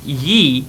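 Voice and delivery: put-on ye, said like an old Scots form of you. Shown as a demonstration. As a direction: say ye in place of you. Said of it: "instead of you".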